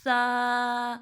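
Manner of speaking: سا held two beats, airy; stretched tha. Shown as a demonstration. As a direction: draw out سا for two counts. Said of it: "اس کو بھی دو الف ایسے کر کے".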